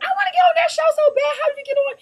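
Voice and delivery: very high, excited squeal of envy; high-pitched retold fan excitement; eager fangirl. Retells her eager wish as fan.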